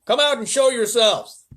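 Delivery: high, loud, slow order; police chief ordering travellers out, loud; commanding shout. Acted. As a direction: bark the order upward. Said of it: "shouted the chief of police".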